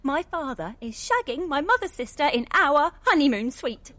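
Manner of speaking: loud indignant rant; angry. Acted. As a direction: rage about the stolen suite. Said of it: "I'm angry".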